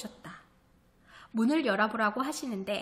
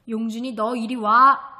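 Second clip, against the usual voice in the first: commanding call; 7 dB louder than usual. Acted. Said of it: "아버지의 호령에".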